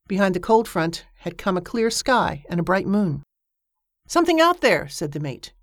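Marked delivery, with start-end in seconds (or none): 4.1-4.9 excited spotting. Acted. Mate spots something in water.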